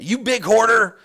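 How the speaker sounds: loud, high mock accusation; accusing shout. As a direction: hurl the insult as a judgmental stranger. Stranger's scolding, corrected to big.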